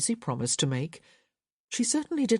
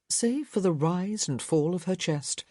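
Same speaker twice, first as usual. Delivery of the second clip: plain reading voice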